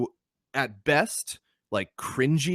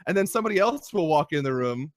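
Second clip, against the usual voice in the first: ordinary talk, nothing marked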